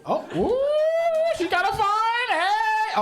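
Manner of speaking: high, loud excited ooh. Real, not acted. To a single woman in the crowd, a high excited ooh. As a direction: squeal it up high at her.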